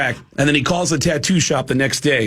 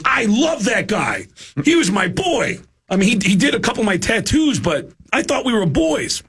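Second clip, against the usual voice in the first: hurt mock drummer; 9 semitones higher than usual. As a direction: plead that he trusted the guy. Drummer defending tattoo artist.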